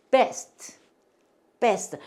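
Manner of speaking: best with a firm B, lips closed at the start; clear B in best. Shown as a demonstration. Teacher modelling best against vest for learners, clear B. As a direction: say best crisply with a B, not a V. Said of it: "우리 발음 정확하게 구분해서".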